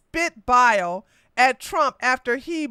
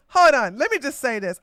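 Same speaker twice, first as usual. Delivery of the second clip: ordinary delivery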